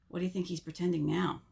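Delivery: ordinary delivery, nothing marked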